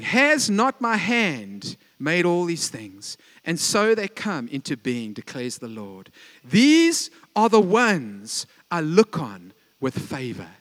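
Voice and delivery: slow, weighty reading of God's words; solemn scripture reading. Acted. Preacher reading Isaiah aloud to the church, slow and solemn. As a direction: lay each word down slowly and gravely. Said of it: "God's saying".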